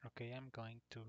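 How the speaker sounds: normal talking voice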